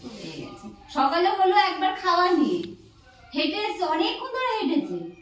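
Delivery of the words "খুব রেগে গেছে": whispered, very soft confidence; barely audible whispered aside; hushed aside. Real, not acted. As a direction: whisper it so she won't hear. Confides that mother is angry.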